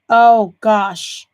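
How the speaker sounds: usual speaking voice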